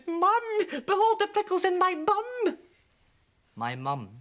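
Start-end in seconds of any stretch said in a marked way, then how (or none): 0.1-2.6 put-on very high, loud childish wail; shouted child. Child wailing to his mum about the prickles, shrill. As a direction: squeal it like a pricked little boy. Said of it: "I shouted".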